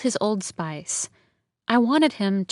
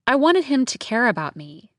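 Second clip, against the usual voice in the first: plain reading voice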